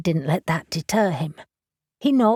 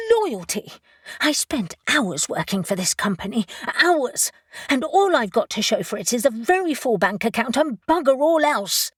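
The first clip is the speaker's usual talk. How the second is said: high indignant outburst; sharp outraged cry; 5 semitones higher than usual. Acted. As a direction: fling the words back high. Wife explodes in argument.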